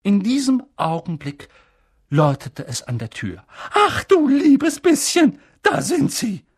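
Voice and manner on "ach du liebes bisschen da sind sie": high, loud and slow, utterly dismayed; aunt crying out in dismay as guests arrive; aghast exclamation. Acted. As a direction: cry out in horror at the doorbell. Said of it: "rief Tante Petunia hellauf entsetzt".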